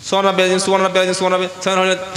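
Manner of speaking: mocking a hurried worshipper gabbling সুবহান রাব্বিয়াল; rushed mumbled prayer. Preacher mimics a careless worshipper rushing through prayer. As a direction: gabble সুবহান রাব্বিয়াল fast, swallowing the words. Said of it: "আপনি মসজিদে দেখবেন".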